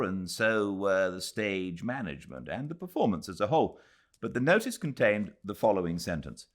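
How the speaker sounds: ordinary delivery, nothing marked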